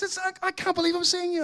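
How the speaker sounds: high, excited stammer; expressive amazement. Retold or imitated. Old acquaintance amazed at seeing the preacher, excited. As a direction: imitate someone overwhelmed by a reunion. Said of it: "he's so expressive".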